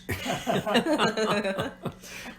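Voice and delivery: loud high burst of laughter; big laugh. Real, not acted. Laughing at own joke.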